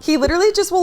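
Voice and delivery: normal talking voice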